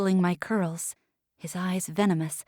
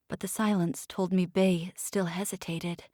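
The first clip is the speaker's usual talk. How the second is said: ordinary delivery, nothing marked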